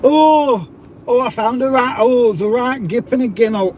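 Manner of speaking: put-on Yorkshire accent. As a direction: overdo the Yorkshire local. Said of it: "Yorkshire accent".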